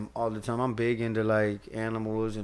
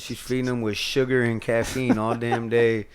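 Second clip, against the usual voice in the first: usual speaking voice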